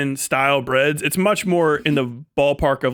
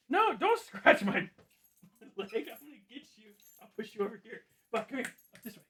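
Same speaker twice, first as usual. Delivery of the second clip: pet talk; 8 semitones higher, 13 dB quieter than usual. Real, not acted. Talking to his pet bug, high cooing pet voice. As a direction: coo at the little pet in a high voice.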